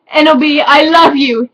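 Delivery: hammed-up love declaration; mock sad shout. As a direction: overplay the tragic lover. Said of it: "he shouted sadly".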